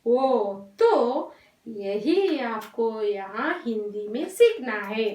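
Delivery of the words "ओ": "ओ" held with a round lip circle; rounded ओ demo. Shown as a demonstration. Teacher to children, modelling rounded "ओ". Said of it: "round हो रहा है ना circle".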